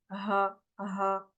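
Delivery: "ha" lightly breathed from the bottom of the throat; deep resonant ha. Shown as a demonstration. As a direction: let "ha" resonate deep down, without rasp. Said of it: "un résonnement au fond de la gorge".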